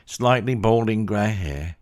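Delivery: ordinary delivery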